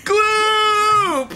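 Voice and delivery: high shouted call; excited shout. Acted. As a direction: shout his name thrilled. Excited party kid calling.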